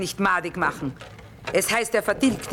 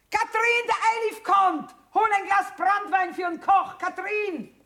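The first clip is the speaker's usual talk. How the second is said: much higher, louder call to someone inside; 13 semitones higher, 3 dB louder than usual. Acted. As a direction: call across the camp to your daughter. Calling daughter in the wagon.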